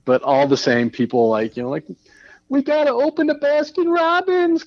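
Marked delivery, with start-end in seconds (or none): 2.5-4.7 high, silly voice for protesters; mock protester voice. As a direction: make fun of the protester demand. Mocking protesters' demand.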